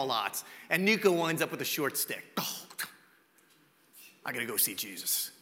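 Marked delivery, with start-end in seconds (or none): 2.4-5.3 low, soft mock groan of defeat; dejected resignation. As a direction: sigh it out like the loser of a draw. Nico draws the short stick.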